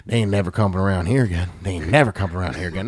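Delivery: low hillbilly-lady impression; lady's mock voice. Storyteller voices the lady about the outsiders, low mock voice. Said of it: "She's like".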